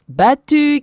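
high, loud victory cry; triumphant shout. Acted. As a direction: shout it as the race winner. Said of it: "cria Edward".

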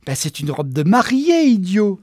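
loud outburst, climbing high; exasperated glee. Acted. Geneviève reveals the wedding dress to Walter, exasperated. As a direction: burst out with the obvious answer.

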